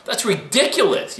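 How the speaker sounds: high, mock-astonished dismissal; retold incredulity. Runner recalling what he used to think, incredulous. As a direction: lift the voice high in disbelief.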